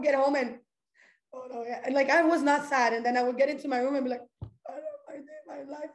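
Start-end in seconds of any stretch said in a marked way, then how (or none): 4.7-5.9 high, hushed fake sobbing; put-on tearful wail, very soft and high; mock crying. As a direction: whimper it out in self-pity. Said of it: "now I'm cry".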